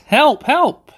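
high, loud mock call for help; mock cry for help. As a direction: call for help in a hammed-up panic. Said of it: "says the tree".

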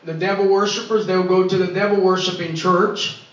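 usual speaking voice

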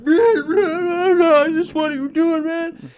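high, slow, loud mock of a cop. Mocking the cop who pulled up, high and loud. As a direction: parody the cop as a whiny fool. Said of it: "he's like".

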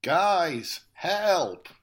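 high, loud cry of Guys, help; calling for help. Acted. Kidnapped [name] shouts to the lads for help, loud and high. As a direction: yell for rescue.

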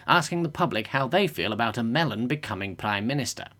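low, brisk anchor read; news anchor. Acted. Anchor Dickie handing to reporter Geoffrey, low newsreader voice. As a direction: hand over to the reporter like a newsreader.

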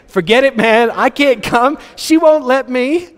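high-pitched mock-pleading husband; hammed-up high voice, slowing on the last words; helpless husband's excuse. Preacher playing the husband begging off supper, high and comically helpless.

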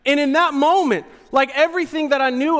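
ordinary talk, nothing marked

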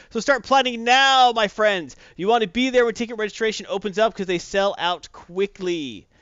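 punchy, emphatic ad-read delivery; event promo announcer. Acted. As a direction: sell the upcoming event like an announcer. Reads event promo to audience.

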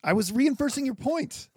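high, loud self-defence; indignant protest. Real, not acted. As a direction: protest your innocence, voice shooting up. Protests at being called racist.

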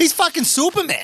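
put-on Boston accent Batman, half laughing; mock Boston Batman. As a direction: ham up a Boston guy whining.

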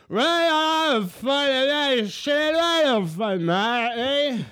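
high, loud hammed-up Australian shouting; drunk Aussie yell. Drunk Australian yelling over club music at him. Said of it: "han bara sitter och skriker".

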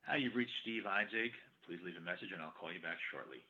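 ordinary delivery

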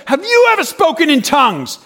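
imitated preacher's loud interrogation; accusing challenge. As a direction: challenge a stranger in front of the crowd.

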